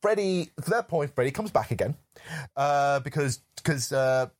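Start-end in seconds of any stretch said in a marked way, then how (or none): none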